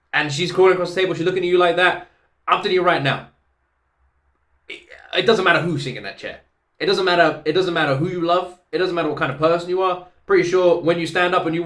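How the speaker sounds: fast, loud and high, bursting with enthusiasm; breathless fan rave; excited gushing. Real, not acted. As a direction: rave about her like you can't contain it. Gushing about [name] performing.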